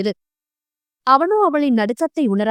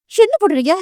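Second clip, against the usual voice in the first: high, tense, louder angry demand; wife pushing him off, gritted-teeth demand; through gritted teeth; 7 semitones higher, 4 dB louder than usual. A narrator's reading. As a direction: spit it through clenched teeth. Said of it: "பல்லை கடித்துக் கொண்டு கேட்டாள்".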